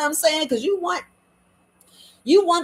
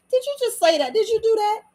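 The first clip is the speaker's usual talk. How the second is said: high-pitched shocked questioning; mock disbelief; 11 semitones higher than usual. Acting out a listener caught off guard, shocked.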